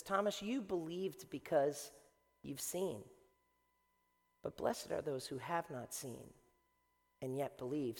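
soft, low, unhurried voice; gentle teaching. Acted. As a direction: say it quietly, letting each phrase settle. Jesus speaks gently to Thomas.

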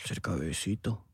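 very soft, low whispering; hushed, mock-intimate whisper; close whisper. Shown as a demonstration. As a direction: coax in a whisper at the mic. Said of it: "No tienes que hablar así".

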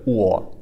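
"o" as u and o put together, very froggy; maximum frogginess. Shown as a demonstration. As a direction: glide froggily from u into o for "o". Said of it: "put together".

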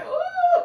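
a high, sudden squeal of delight; excited ooh. Real, not acted. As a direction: squeal as new viewers arrive. Host greets viewers joining live.

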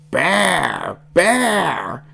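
put-on high, rough baby monster shout; wild one-word creature yell; wild creature cry. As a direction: call out like an excitable little beast. Animal bounces and calls out wildly.

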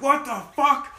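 high, excited mock outburst; stunned excitement. Stern-fan friend hearing the internship news, high excited outburst. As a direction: imitate a friend losing it.

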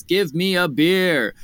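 mock super angry. Bear back on the third day, mock super angry. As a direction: act the angry bear, drawing the demand out. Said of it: "he comes back super angry".

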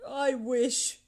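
wistful wish, begun and left hanging; muttered regret. A narrator's reading. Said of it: "Scrooge muttered".

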